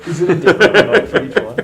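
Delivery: laughing question, high and loud. Real, not acted. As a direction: ask it through laughter. Joking question while laughing.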